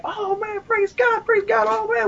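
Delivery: very high, imitated ecstatic praise; going crazy. Two friends jumping up and down over a healing, going crazy. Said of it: "going crazy".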